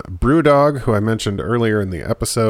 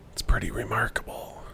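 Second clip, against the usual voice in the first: hushed aside; whispering; 10 dB quieter than usual. Real, not acted. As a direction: breathe it as a secret.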